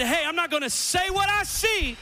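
high, loud declaration of a made-up mind; acted resolve. Congregant declaring a bold decision, high and loud. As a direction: voice the listener deciding boldly. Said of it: "make up your mind".

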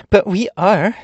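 high, loud, foot-stamping insistence; impatient cry. A narrator's reading. Ginger to the guardian, impatient loud cry. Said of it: "cried Ginger, stamping her foot impatiently".

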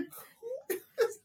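squeaky high-pitched startled voice, slow and soft; mock-startled squeak. Retelling a startled character's reaction.

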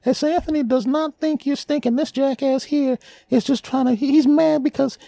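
squeaky high voice. Acted. High squeaky protest voice again.

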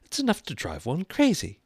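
high-pitched grumble to oneself; muttered complaint. A narrator's reading. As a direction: complain to yourself in a high girl's voice. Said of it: "she muttered to herself".

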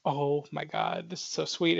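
soft and slow, melting; touched. Retold or imitated. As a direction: breathe it out, overwhelmed by sweetness. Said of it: "And I'm just like".

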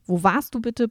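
usual speaking voice

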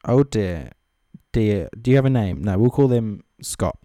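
normal talking voice

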